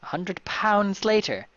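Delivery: high, loud, slow put-on voice; hammed-up exclamation. Master orders the man paid, hammed-up and high. Reader puts on a voice.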